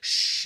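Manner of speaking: long shushing ocean wave; imitated ocean hiss. Storyteller setting the scene where you can hear the ocean, imitated wave noise. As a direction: imitate surf with a drawn-out shh.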